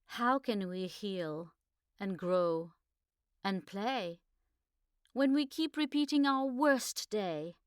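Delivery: measured, earnest poem recital; poem reading. Acted. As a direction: read the verse with feeling, rising to protest. Reads her poem aloud.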